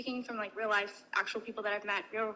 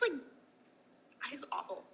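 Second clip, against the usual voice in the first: whispering; 2 semitones higher, 7 dB quieter, much slower than usual. Real, not acted. Disgusted aside after impression.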